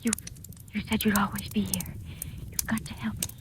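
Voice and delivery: desperate hushed whisper to Carl; urgent whisper. Acted. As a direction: whisper so the aunt can't hear. Secret plea, aunt nearby.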